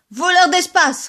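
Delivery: high, loud snapped insult; angry retort. Acted. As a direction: fling it back at him. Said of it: "répliquait monsieur Grisou". (réplique furieuse, aiguë et forte)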